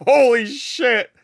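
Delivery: ordinary talk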